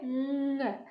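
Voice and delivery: न held very long through the nose; drawn-out nasal न. Shown as a demonstration. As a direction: hum out न slowly. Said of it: "नाक से भी हमें लग रहा है कि कुछ ध्वनि". (न को नाक से खींचकर बोलें)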